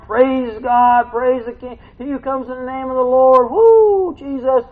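high, loud excited praise; joyful hollering praise, with a whoop; crowd praising. Retold or imitated. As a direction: shout it like the crowd at the gate. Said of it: "all these people screaming, hollering".

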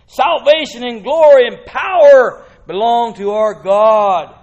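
loud, ringing proclamation; exclaiming crowd. Acted. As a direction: proclaim it at full force. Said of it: "a great crowd in heaven exclaiming".

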